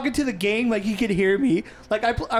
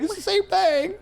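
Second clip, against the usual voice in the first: retold whining; 9 semitones higher, slower than usual. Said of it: "when I was getting like".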